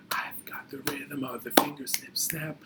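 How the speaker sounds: soft unvoiced whisper of the chant line; whispered echo. Teacher repeating the rhyme for kids, whispering. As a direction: breathe it as a secret.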